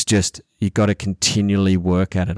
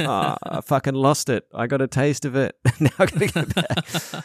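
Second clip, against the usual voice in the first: higher, laughing lament at losing it; mock dismayed self; 5 semitones higher than usual. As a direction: groan playfully at having to start over. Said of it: "It's like".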